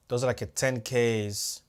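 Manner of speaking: ordinary delivery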